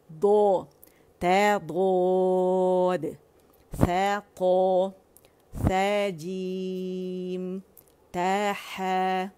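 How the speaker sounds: slow, loud, drawn-out letter drill; drilled Arabic letters. Shown as a demonstration. Tutor drilling students, slow loud letters. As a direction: sound out each letter slowly for the class. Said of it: "दौद के लिए आवाज़ sideways आपको आनी चाहिए".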